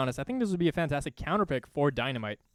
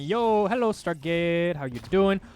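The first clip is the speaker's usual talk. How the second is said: playful greeting; 3 semitones higher, 5 dB louder, slower than usual. Pretend. Voicing a player greeting a Stargate.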